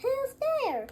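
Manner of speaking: a tiny startled mouse; high mouse voice. Acted. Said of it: "Mouse cries".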